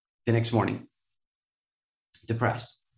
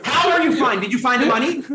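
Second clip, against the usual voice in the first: higher, louder mock-incredulous questioning; incredulous demand; 11 semitones higher, 6 dB louder than usual. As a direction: voice the accountant who can't believe it.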